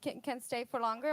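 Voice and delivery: ordinary talk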